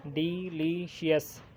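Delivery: Delicious drawn out slowly, each syllable separate; slow syllable count. Shown as a demonstration. Teacher showing students the syllables, slow and careful. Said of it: "three हैं".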